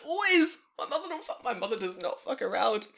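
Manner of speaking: high, loud, laughing burst; laughing about a mother who calls the bluff; laughing exclamation. Real, not acted. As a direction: laugh it out in delight. Laughing emphatic agreement.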